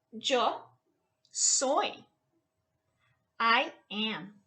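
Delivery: ordinary talk, nothing marked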